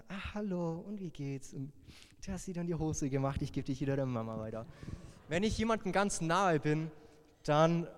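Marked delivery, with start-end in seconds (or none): 0.1-4.6 put-on hushed, gentle talk to a baby held in his arms; soft baby talk. Friend's baby on his arm, soft gentle cooing. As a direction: coo quietly to a newborn. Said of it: "schreie ich das Kind nicht an".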